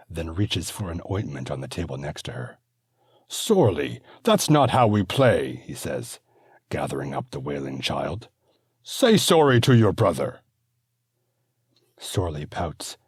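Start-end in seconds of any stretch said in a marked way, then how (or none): 3.3-5.6 put-on raised, higher voice telling off a child; father correcting his fighting sons, raised and stern; firm scolding. 8.8-10.4 put-on raised, higher voice telling off a child; father correcting his fighting sons, raised and stern; firm scolding.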